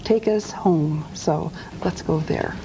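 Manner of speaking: normal talking voice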